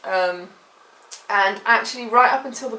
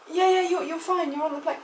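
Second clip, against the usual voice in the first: much higher, light reassuring agent voice with a laugh; 8 semitones higher than usual. Retold or imitated. Check-in agent reassuring the tearful traveller, high and light. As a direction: play the agent soothing a crying passenger.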